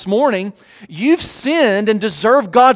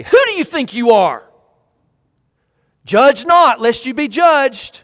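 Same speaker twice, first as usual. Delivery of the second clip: loud, indignant, pitched up high; defensive retort; 6 semitones higher, 6 dB louder than usual. Pretend. Someone confronted about sin snapping back defensively. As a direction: snap back defensively, offended. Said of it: "get defensive and say".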